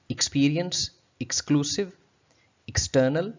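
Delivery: experience with a short ik at the start, not eks; correct pronunciation. Shown as a demonstration. Teacher modelling the correct ik sound. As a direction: exclusive and external begun ik, not eks. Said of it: "rather than experience और exclusive और external".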